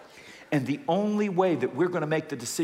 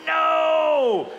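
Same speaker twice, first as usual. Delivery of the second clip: high, loud shouted no; emphatic shout; 11 semitones higher, 6 dB louder than usual. Acted. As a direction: bark out a flat refusal. Emphatic refusal.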